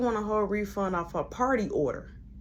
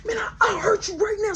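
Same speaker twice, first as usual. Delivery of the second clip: choking out a threat; mock threat; 12 semitones higher than usual. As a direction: threaten him mid-grapple. Threatening during the fight.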